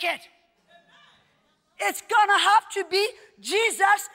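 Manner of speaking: loud, emphatic sermon delivery; impassioned preaching. Real, not acted. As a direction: preach it with rising force to a high peak. Preaches at full force.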